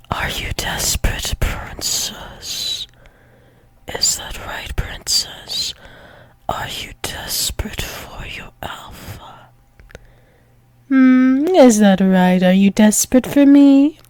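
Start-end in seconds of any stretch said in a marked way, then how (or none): 0.1-5.7 breathy, unvoiced-style teasing whisper. Intimate teasing of a partner. 6.5-9.5 very soft, intimate whisper; teasing whisper. Acted.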